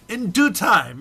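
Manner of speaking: words squeezed out through a laugh. Real, not acted. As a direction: giggle through the phrase. Laughing at own reading.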